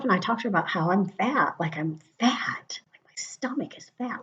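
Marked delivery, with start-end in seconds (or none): 1.6-4.2 barely voiced admission; whispered confession. Real, not acted. Telling viewers about her stomach, in a whispered confession. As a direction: whisper it like a shameful secret.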